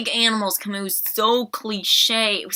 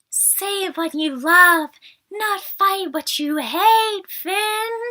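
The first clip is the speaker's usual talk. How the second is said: high, slow, drawn-out mock-earnest speech; hammed-up heartfelt movie line; mocking Rose impression; 10 semitones higher, much slower than usual. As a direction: stretch every word in a high, sappy plea. Mocking Rose's big speech.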